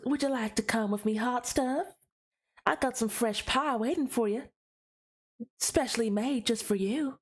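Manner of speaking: flirt like a Southern Belle in a saloon; Southern Belle accent. Shown as a demonstration. Streamer tries a flirty line for viewers, Southern Belle accent. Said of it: "I can also do a Southern Belle accent".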